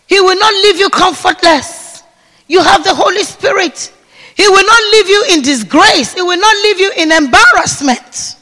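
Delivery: emphatic sermon; fervent preaching. Real, not acted. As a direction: press each promise home. Preaching at full force.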